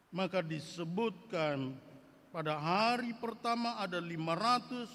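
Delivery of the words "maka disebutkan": ordinary talk, nothing marked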